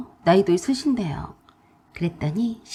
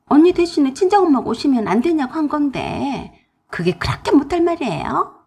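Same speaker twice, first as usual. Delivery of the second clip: higher, louder indignant retort; angry sister-in-law; 4 semitones higher, 5 dB louder than usual. Acted. Sister-in-law angrily snaps back at narrator. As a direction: snap back in offense. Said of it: "더 화를 냈어요".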